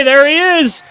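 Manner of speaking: loud, rising cheer, pitched high; excited shout. Real, not acted. Reacting to his player already down the court, excited shout.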